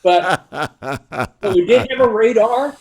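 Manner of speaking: chuckling through the words, slow; amused, laughing as he says it; a slow, laughing aside. Real, not acted. Amused recalling radar character.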